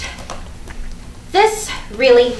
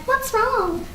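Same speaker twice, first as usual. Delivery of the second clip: mock comment; 11 semitones higher than usual. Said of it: "She just wants someone to comment".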